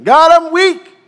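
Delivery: loud anguished prayer; pleading cry. Acted. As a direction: cry out God, I'm weak. Gideon's cry to God.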